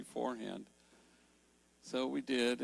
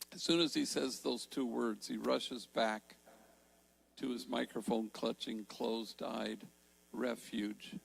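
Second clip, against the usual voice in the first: ordinary talk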